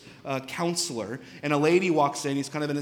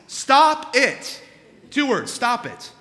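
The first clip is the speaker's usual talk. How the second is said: loud, high, emphatic comic command; shouted order; 9 semitones higher, 9 dB louder, slower than usual. Acted. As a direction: hammer it home with exasperated force. Counselor insists, shouting his two words.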